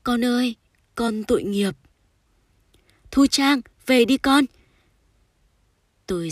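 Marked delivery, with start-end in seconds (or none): 0.0-4.5 high, loud beckoning call; raised, pleading call that swells louder. A narrator's reading. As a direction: call a lost child home across the river. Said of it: "như có tiếng ai đó vẫy gọi".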